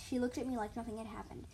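ordinary delivery, nothing marked

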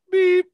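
loud, very high beep; imitated phone beep. Prankster mimics his friend's phone key, high loud beep. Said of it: "Press one beep for yes".

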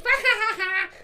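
put-on high, loud toddler chant; mother imitating her toddler playfully chanting; giggly toddler. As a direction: chant it like a giggling little girl.

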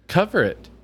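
high, loud exasperation; outraged at the open can; exasperated. Real, not acted. Scolding partner about open can.

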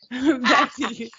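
high, slow words through laughter; drawn out and helplessly amused; laughing. Real, not acted. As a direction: try to move on while cracking up. Host trying to resume while laughing.

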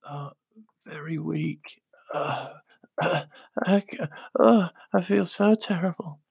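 weak, whispery sick-note voice; faking being sick. Retold or imitated. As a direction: put on a feeble sick voice. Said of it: "sick note voice".

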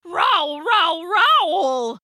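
high, drawn-out imitated roars; lions yowling. A narrator's reading. Lions fleeing the noisy cave, yowling. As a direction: yowl it like fleeing big cats. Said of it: "went the lions".

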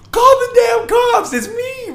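high, loud, slow exasperated shout; retold outraged shout at a doorman; yelling. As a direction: yell it at the bouncer in disbelief. Retold fight with bouncer.

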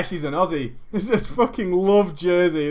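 usual speaking voice

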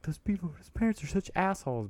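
high, snooty disapproval; mock judging stranger. Parent imitating strangers judging them, high and snooty. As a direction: sneer like a judgy diner. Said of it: "I'm sure people are like".

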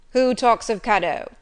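loud, gruff retort. A narrator's reading. Master answers the child's request for a present, gruffly. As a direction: cut in gruffly and forcefully. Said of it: "said he gruffly".

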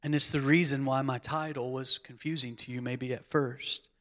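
ordinary talk, nothing marked

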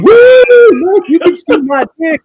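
high squealing mock show-off; mocking falsetto. Mocking the dad's version of his fitting-room moment in a high squeal. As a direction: squeal it like a silly exhibitionist.

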